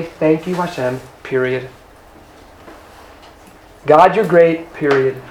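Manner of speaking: quiet, low and sincere; a quiet whole-hearted thank-you to God; prayerful whisper of thanks to Hashem; hushed sincere thanks. Acted. Pure thanks at Maggid.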